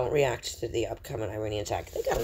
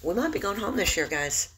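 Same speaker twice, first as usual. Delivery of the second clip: higher and louder, bursting with hope; excited; 8 semitones higher, 4 dB louder than usual. Real, not acted. Telling his followers the end may be near, excited. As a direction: lift it up like joyous news.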